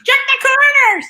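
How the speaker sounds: very high, loud imitated shout; mock yelling. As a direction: holler it like a frantic gaming partner. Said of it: "She's yelling at you to check".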